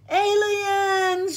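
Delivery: very high, loud shriek; full-voiced storybook shriek of fright; terrified scream. A narrator's reading. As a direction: scream it in alarm, stretched out high. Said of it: "she screamed".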